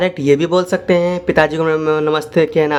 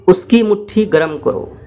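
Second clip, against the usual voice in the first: ordinary talk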